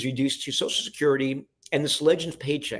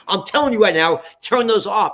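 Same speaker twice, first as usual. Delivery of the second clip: loud, raised-pitch command; angry order; 6 semitones higher, 6 dB louder than usual. Retold or imitated. Boss ordering the cameraman to stop shooting, angry and loud. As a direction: imitate an irate boss laying down the law.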